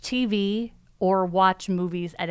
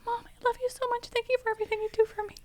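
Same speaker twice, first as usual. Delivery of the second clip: very high, soft, fast grateful dog voice; talking dog; 6 dB quieter, faster than usual. Retold or imitated. Owner voicing her obedient dog thanking mom, very high. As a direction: gush like a devoted little dog. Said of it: "he would just say".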